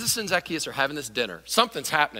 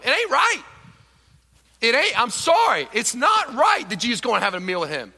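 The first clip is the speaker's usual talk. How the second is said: resentful crowd; 7 semitones higher, 5 dB louder than usual. Acted. Preacher voicing the resentful crowd.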